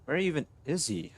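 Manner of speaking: ordinary talk, nothing marked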